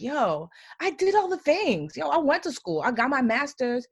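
retold frustrated rant at God. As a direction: vent the unfairness, rising up high.

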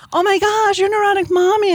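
a stranger recognizing her, squealing high and loud; thrilled fan. Retold or imitated. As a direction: shriek it like a fan who just realized. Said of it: "they're like".

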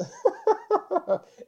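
high, loud burst of laughter; squealing laugh. Real, not acted. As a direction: crack up at yourself. Laughs at own claim.

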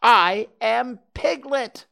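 high, loud, word by word; loud declaration. A narrator's reading. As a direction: insist on who you are, slowly and forcefully. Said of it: "said Piglet loudly".

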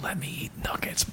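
hushed whisper. Acted. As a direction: whisper it so nobody hears. Whispered argument continues.

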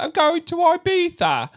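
high, drawn-out Cockney impression; mock Cockney accent. Son repeating his British mom's mock Cockney accent. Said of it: "she'd do stuff like that".

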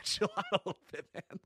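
quick, high, laughing plea. Real, not acted. Host laughing at the wrestler's brutal kicks. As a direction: laugh it out while telling him to ease up.

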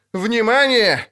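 high-pitched warning call; крик. A narrator's reading. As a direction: call down to get their attention. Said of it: "крикнул сверху Лютик". (крикнуть сверху высоким голосом)

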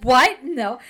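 loud, startled retold outburst; mock panic. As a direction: act out a burst of panic, loud on the first word.

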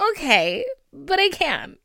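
defiant okay but I can't; stubborn refusal. Retold or imitated. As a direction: dig your heels in. Refusing sister's advice.

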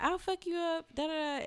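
high mock threat. The girl threatening to fight her, mocked high and silly. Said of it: "And she's like".

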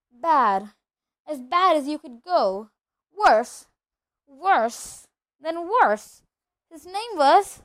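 loud, heavily weighted words with pauses; mounting dread. Acted. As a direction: build emphatically towards the dreaded name. Struggling to name dreaded wizard.

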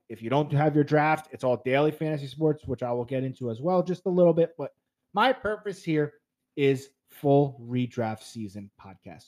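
ordinary talk

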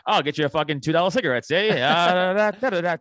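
hammed-up old-timey tough guy, fast and loud; mock tough guy. Riffing on the busy spot, a hammed-up loud gangster voice.

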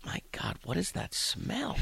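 whispered then squeaky high disgusted question. Retold or imitated. New coach hit by the sewer smell outside his door, whispered disgust. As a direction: recoil from the stench. Sudden stench outside the apartment.

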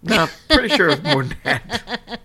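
high, slow, laughing tease. Real, not acted. Teasing overstatement, laughing.

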